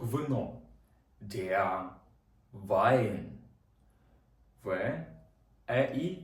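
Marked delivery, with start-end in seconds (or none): 2.5-3.2 Wein said as vain, ei like ai; German ei as ai. Shown as a demonstration. Teacher demonstrates German word to class: Wein with ai. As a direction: model Wein with a clear ai. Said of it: "Читається як ай".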